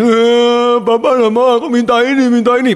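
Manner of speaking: high-pitched mock bawling; loud, high whiny tantrum; crying child. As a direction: wail it like a demanding kid in tears. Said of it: "pada waktu anaknya nangis".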